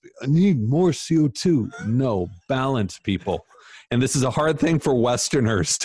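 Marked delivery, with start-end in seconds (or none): 0.2-1.7 higher, louder mock voice of an overeager breather; mock breath-holder. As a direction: ham up a silly gym guy begging for CO2. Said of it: "start holding your breath".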